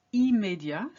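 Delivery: immédiat opening on "ee" with the m sounded; clear "im" opening. Shown as a demonstration. Teacher demonstrating the rule, clear "im" opening. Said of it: "starts with the sound im".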